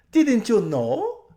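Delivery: very high, loud, slow and laughing; thrilled spoiled socialite; gushing society lady. A narrator's reading. Lasaraline voiced.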